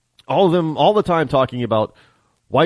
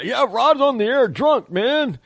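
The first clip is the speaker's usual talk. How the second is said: higher-pitched mock dumb listener; mocking a fool listener; 10 semitones higher than usual. Host mocking a listener who thinks he's drunk, higher mock voice. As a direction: sneer in a dopey higher voice. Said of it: "some damn fool out there ... and say".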